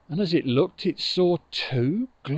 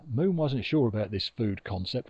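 ordinary delivery

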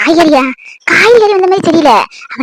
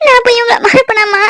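high, sobbing outcry; the daughter protests to her mother that she never forgot her, crying; 6 semitones higher than usual. Acted.

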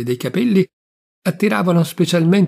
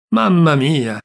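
Mamma mia cried out louder in despair; louder despairing cry; 4 dB louder, slower than usual. Acted. Old uncle despairs over his mistake, crying out louder.

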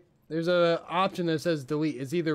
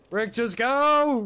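drawn-out desperate yell, much higher; shouting; 10 semitones higher, 8 dB louder, much slower than usual. Real, not acted. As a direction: yell it across the fight. Yelling at teammate mid-fight.

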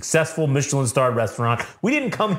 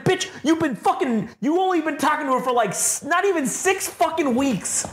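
scolding in a much higher voice; high mock scolding; 13 semitones higher than usual. Talking back to Carmy on screen, high mock scolding. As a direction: scold him, pitched way up. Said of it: "I was like".

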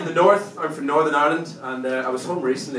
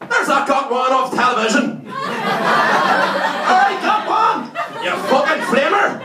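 put-on high, loud heckling old lady; jeering shout; 13 semitones higher, 6 dB louder, slower than usual. Old lady pointing him out to her mate, jeering shout. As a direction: yell it out as a jeer across the street. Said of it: "turns to her mate, and goes".

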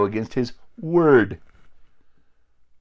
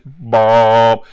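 horn blast; 11 dB louder than usual. Retold or imitated. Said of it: "like a loud horn".

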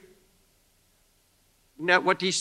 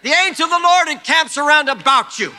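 full-force preacher shout, higher and louder; preaching shout; 6 semitones higher, 8 dB louder than usual. Acted. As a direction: shout the promise over the congregation.

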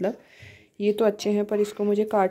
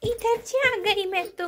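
put-on higher, slow cutesy baby voice; baby talk; 11 semitones higher, slower than usual. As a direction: coo it slowly like talking for a toddler. Mother cooing at sulking toddler. (धीमी, ऊँची, तोतली बच्चों वाली आवाज़)

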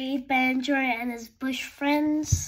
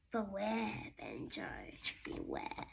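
much lower, softer, fading into a breathless rasp; menacing crocodile; 5 semitones lower, 14 dB quieter than usual. Acted. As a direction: warn like a sinister croc, dropping away. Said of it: "my not-so-friendly crocodile".

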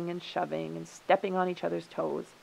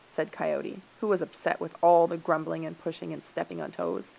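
plain reading voice

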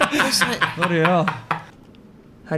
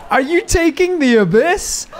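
hammed-up punchline; 10 semitones higher, 4 dB louder than usual. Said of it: "And then I said".

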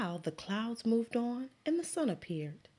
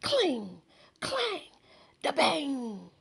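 louder, higher banging sounds; loud clanging; 7 semitones higher, 4 dB louder, slower than usual. Acted. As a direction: bang it out like pounding cans. Max pounding garbage cans.

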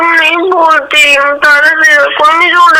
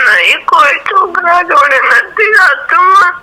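real sobbing denial; crying; 2 semitones higher than usual. Real, not acted. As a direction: plead through tears, voice rising. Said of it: "બસ હવે રોવાનું બંધ કર યાર".

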